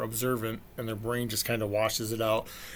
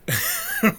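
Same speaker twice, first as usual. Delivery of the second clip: burst of high laughter; 5 dB louder than usual. Real, not acted. Laugh at the surprise girl.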